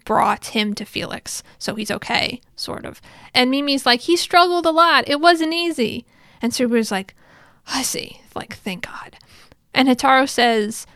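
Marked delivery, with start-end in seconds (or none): 4.0-6.1 higher, lightly voiced kid; perky retold kid voice, pitched up; childlike. Acted little kid Mimi reporting. 7.7-9.2 hushed, breathed-out relief; relieved. Retold or imitated. Acted relief at friend's survival.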